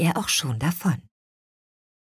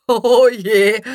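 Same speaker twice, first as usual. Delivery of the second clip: loud, jolly exclamation; amused; 6 semitones higher, 8 dB louder than usual. A narrator's reading. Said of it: "lachte ihr Vater".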